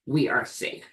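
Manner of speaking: put-on calm your body like a frightened child; soothing self-talk. Shown as a demonstration. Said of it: "But I say".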